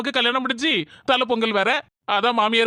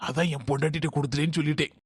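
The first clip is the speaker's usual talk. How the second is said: private aside; 8 semitones lower, 5 dB quieter, faster than usual. Acted. Brother's private aside after dodging request.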